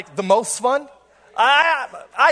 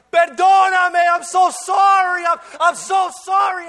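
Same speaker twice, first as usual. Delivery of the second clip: high, loud, slow mortified apologies; panicked yelling; 10 semitones higher, 6 dB louder, slower than usual. Retold or imitated. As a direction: shout sorry through the slammed door. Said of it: "I start yelling".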